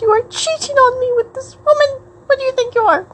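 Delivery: high, hammed-up accusing shriek; mock jealous lover. Pretend jealous lover throws water.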